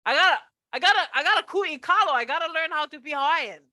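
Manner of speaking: high, loud burst of acted eagerness; fired up. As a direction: exclaim it like someone suddenly ignited. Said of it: "I feel fired up".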